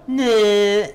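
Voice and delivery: loud, high cartoonish anime noise; anime reaction. Game master jokes how a wink would go, anime-style. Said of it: "if this was an anime".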